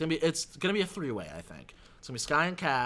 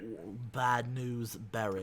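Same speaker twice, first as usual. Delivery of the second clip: slow, self-conscious attempt at a British accent; half-hearted British accent imitation; bad British accent; 4 semitones lower, 5 dB quieter, slower than usual. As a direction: try a British accent and overdo it a little. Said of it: "for my horrible accent attempt".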